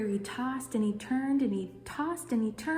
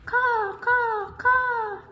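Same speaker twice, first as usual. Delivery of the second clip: loud crow caws; 14 semitones higher, 8 dB louder, much slower than usual. Acted. Crow call in story.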